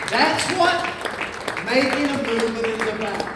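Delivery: loud, high rally cry to the crowd; stage hype shout; preaching at full force. Acted. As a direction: fire up the room, shouting. Hype speech to standing crowd.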